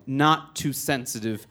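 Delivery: slow, deliberate correction at normal pitch; unhurried, controlled comeback; measured retort. Retold or imitated. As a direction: answer the heckler evenly, word by word. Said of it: "And I say".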